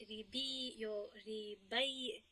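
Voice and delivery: ریبی drawn out slowly as the mistaken form; wrong pronunciation shown. Shown as a demonstration. Teacher slowly voicing the wrong form ریبی for students. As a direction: say ریبی slowly, as the error to avoid.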